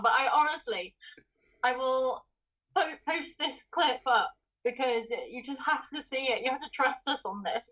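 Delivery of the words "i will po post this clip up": ordinary talk, nothing marked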